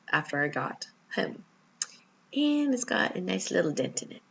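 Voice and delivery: usual speaking voice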